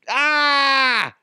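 long, high hammed-up "aah"; comic high-pitched scream; mock panicked wail. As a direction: cry out as if caught. Retold panic at being found out.

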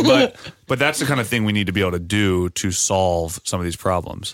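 usual speaking voice

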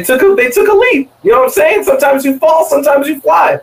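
rally the crowd like a motivational speaker; excited hype. Pretend. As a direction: pitch leaps high, last word shouted. Hyping the team's bold gamble.

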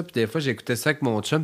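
usual speaking voice

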